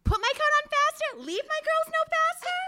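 very high, mock-incredulous questioning; dramatic lyric reading. Guest questioning caller in lyric reading, high mock indignation. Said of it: "have you read some lyrics of hers".

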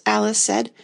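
plain reading voice

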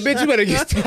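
high, loud comic outburst; half-laughing indignant yell; mock angry warning. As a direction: snap it like a fed-up retelling. Retold warning to pushy lady.

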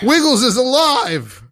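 high, drawn-out cry of amazement; overjoyed shout. Acted. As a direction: proclaim a miracle at the top of the range. Improv kitten revives.

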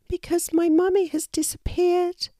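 put-on very high child-animal voice; high-pitched, sad storybook youngster; sad little wallaby. A narrator's reading. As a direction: play a tiny wallaby, sadly explaining. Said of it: "replied the wallaby sadly".

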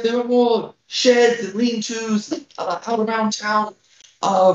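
put-on frail old village elder, worried; a hint of a tiny old lady pleading; very old woman. GM voicing elder Grilda.

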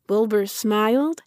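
usual speaking voice

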